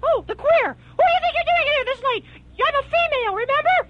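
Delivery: high, fast, shrill-voiced woman; shrieking old woman. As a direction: screech at the intruder at top speed. Rose scolding intruder.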